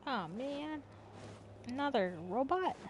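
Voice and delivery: usual speaking voice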